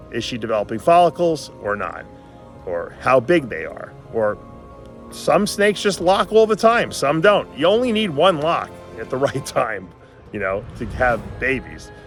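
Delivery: ordinary talk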